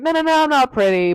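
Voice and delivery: mock modest protest. Dorian brushing off Henry's compliment, mock protest. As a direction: wave off a compliment, lightly acted.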